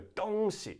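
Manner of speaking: "东西" pitched very high on "东"; high first tone. Shown as a demonstration. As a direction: hold "东" way up high, then drop on "西". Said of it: "the high note".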